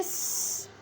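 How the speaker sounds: स as a long bare "sss" without a vowel; letter sound. Shown as a demonstration. Teacher giving the sound of seen, स held as "sss". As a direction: hold स as pure friction. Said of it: "सीन की आवाज़ में".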